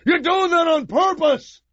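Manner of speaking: high whiny yell at brother; a kid screeching that he did it on purpose. Retold or imitated. Boy yelling at brother for ruining his show, high shout. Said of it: "I used to go".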